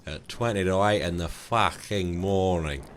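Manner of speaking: put-on broad Australian accent, stretched vowels; hearty, slow Australian impression; Aussie guy. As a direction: drawl it like a true-blue Aussie bloke. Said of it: "that fucking Aussie guy".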